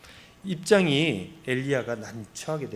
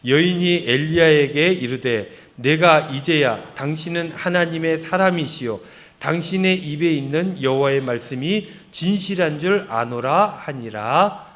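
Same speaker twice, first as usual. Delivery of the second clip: loud congregational reading; 8 dB louder than usual. Shown as a demonstration. Pastor leading the congregation's reading, loud and slow. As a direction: read out loud and slow in unison with the church. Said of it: "같이 읽습니다. 여인이, 시작".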